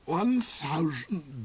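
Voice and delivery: slow, soft stunned groan; surprised groan. A narrator's reading. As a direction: let the number sink in slowly, aghast. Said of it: "groaned the Duke in a tone of vast surprise".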